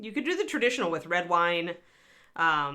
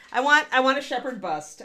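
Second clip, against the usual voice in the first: ordinary delivery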